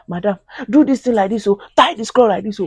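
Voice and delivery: mock know-it-all auntie; bossy advice. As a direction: nag the new mother mockingly.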